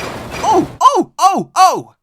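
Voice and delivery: very high, very loud squeals; screaming trucks. A narrator's reading. Silly trucks bumped by Edward, screaming high. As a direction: shriek like startled trucks. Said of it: "they cried again".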